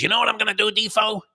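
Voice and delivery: a friend excitedly pitching his bet; mock excited friend. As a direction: play the pumped-up friend with a big idea. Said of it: "and he says".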